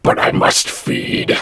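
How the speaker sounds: slow, voiceless layered ghoul whisper; a possessed whisper, cold and hollow; ghastly layered voice. Acted. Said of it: "ghastly layered voice of Lorna".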